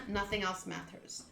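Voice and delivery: ordinary talk, nothing marked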